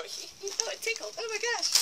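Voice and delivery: high, soft giggly squeal; squealing ticklish visitor. Retold or imitated. Guide mimics people who don't like the tickle, high soft squeal. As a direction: mimic someone being tickled, tiny high voice.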